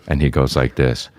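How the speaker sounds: ordinary talk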